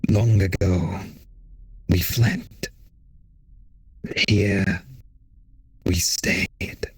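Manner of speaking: slow, eerie hive voice; many beings speaking as one; swarm queen voice. Acted.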